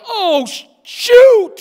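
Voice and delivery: sudden high yelp of alarm, cut off and swallowed; a startled yelp that shoots up and bursts loud; panicked yelp. Retold or imitated. A young man spotting his future father-in-law, yelping in panic. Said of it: "And I was like".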